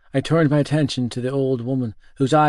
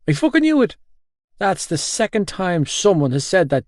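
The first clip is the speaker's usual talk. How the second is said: panicked outburst; 6 semitones higher than usual. Acted. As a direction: cry out as if proven right. Gibbsy panics, repeats his outburst.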